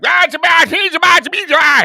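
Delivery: very loud, high garbled tirade; mock ranting neighbour. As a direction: go off in a hammed-up unintelligible rant. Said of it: "he would just start going off like".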